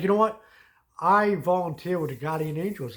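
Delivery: heated retold declaration; retold loud offer. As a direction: push it hard at her. Retelling his heated offer.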